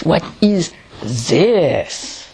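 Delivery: THIS stretched out long after a slow "What is"; very slow, leaning long on "this"; stress on THIS. Shown as a demonstration. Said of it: "Or more on the this".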